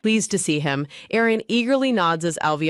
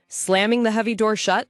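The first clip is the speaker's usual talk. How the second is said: plain reading voice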